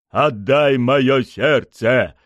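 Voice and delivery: loud, very slow menacing command; bloodthirsty demand. A narrator's reading. Victim demanding his heart from his killers, slow and menacing. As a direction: demand it word by word with a bloodthirsty grin. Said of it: "потребовал я с кровожадной улыбкой".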